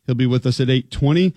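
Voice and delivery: usual speaking voice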